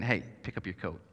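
mean it as a plain order, not a question; blunt command. Retold or imitated. Parent ordering a child to pick up the coat. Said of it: "Which is really polite saying".

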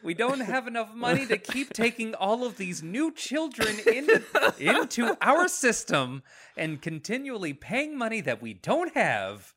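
playing a fretting taxpayer through laughter; mock pearl-clutching rant, breaking into giggles; mock outraged politician.

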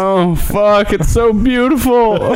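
high, drawn-out tearful wail; hammed-up weepy gushing; mock crying. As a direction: wail it as if moved to tears. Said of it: "that show will make me cry sometimes".

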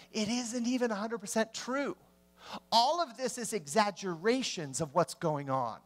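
ordinary delivery

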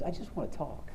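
a hint of a gentle God just wanting to talk; calm God voice. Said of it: "and God just goes".

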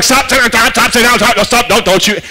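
rapid-fire mock yelling; flustered bus driver's mock yell; imitated yelling at kids. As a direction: yell a string of orders, half in earnest. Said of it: "just yelling at kids".

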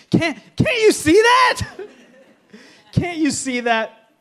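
high, loud laughing exasperation; exasperated wife. Retold or imitated. Wife scolding husband who can't find things, high and laughing. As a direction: shriek it at him, then laugh.

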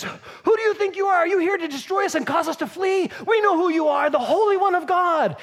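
high-pitched challenge building to a shout; confrontational demon. Acted. Demon-possessed man confronts Jesus in church, confrontational shout. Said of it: "confronts him and says".

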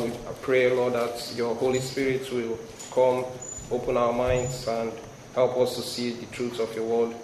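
ordinary delivery

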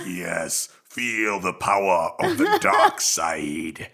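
low, very slow villain voice; drawn-out, deep sinister command; Darth Vader. Acted. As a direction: intone it like a menacing dark lord. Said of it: "I dressed up as, as Darth Vader".

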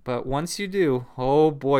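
normal talking voice

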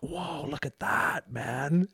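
slow drawn-out awed whoa; amazed whoa. Retold or imitated. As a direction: gawk like an impressed bystander. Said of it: "People were like".

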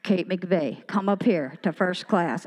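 low, slow pilot announcement; pilot on intercom. Pretend. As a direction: imitate a pilot paging a passenger. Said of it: "come over that intercom and go".

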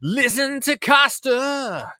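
loud, high and slow, stretching every word; loud drawn-out greeting. Real, not acted. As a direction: belt the welcome out slowly like a big announcement. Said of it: "that was quite loud to start off with".

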